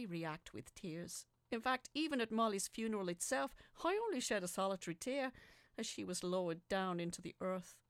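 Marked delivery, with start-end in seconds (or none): none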